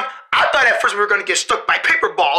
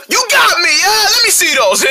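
excited; 9 semitones higher than usual. Acted. Kids playing in ad.

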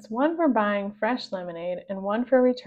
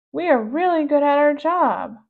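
pug voice; 5 semitones higher, 6 dB louder than usual. Acted. Pug character voice.

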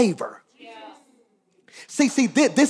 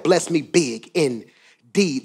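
slow plea; 6 semitones lower, 3 dB quieter, much slower than usual. Acted. Preacher acts out Jabez's plea.

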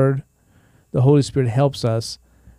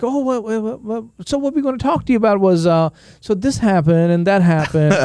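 family on the phone; 7 semitones higher than usual. Retold or imitated. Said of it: "So they're like".

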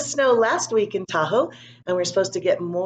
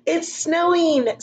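put-on higher, drawn-out happy child voice; excited little boy; 11 semitones higher, 3 dB louder, slower than usual. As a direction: sound like a kid thrilled by the first flake.

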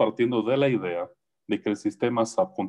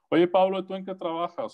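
imitating a curious relative; 7 semitones higher than usual. Relatives asking about job.

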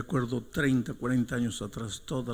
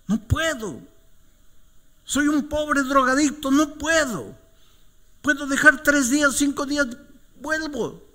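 very high, loud desperate cry; acted anguished yelling at the pastor; despairing shout; 7 dB louder than usual. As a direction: cry out like a defeated addict giving up.

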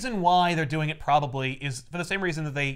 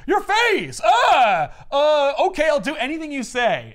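much higher, loud panicked scream then cowed surrender; terrified shout; 10 semitones higher, 7 dB louder, slower than usual. Acted. Technician screaming at Lynn's face, much higher and loud. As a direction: shriek at her face, then cave in. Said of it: "he goes".

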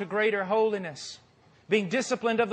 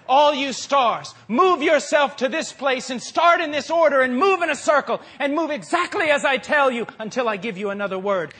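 commanding voice of God; 5 semitones higher, 6 dB louder than usual. Acted. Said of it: "and he says".

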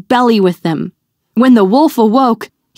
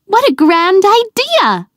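put-on much higher, delighted girl; little girl thrilled, pitched way up; excited exclaim; 10 semitones higher than usual. A narrator's reading. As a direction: squeal with glee at the plan. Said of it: "the girl exclaimed".

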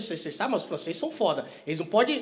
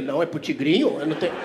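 mock gambler; 8 semitones lower than usual. Voices a gambler answering.

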